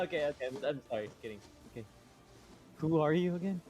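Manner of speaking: usual speaking voice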